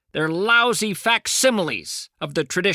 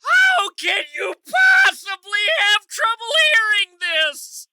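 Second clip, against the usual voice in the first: much higher, louder exasperated shout; 13 semitones higher, 3 dB louder than usual. Real, not acted. Caller shouting at automated line, exasperated shout. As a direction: yell so the machine hears. Yelling at phone robot.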